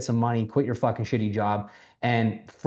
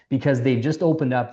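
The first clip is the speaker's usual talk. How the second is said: usual speaking voice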